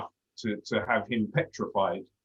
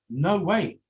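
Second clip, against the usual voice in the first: emphatic; 8 semitones higher, 4 dB louder than usual. Real, not acted. Emphatic denial about herself.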